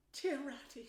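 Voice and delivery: high, pleading little voice; poor Mole. A narrator's reading. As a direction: appeal weakly to a friend. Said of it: "said the poor Mole".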